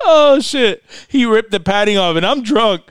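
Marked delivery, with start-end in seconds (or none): 0.1-0.8 high laughing oh shit; laughing exclamation. Real, not acted. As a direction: blurt it out through laughter. Padding ripped off, laughing.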